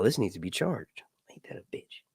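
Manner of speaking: soft whispered grumble; whispered aside. Real, not acted. Speaker grumbling to himself about an uncharged device, whispered. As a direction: whisper it to yourself, annoyed.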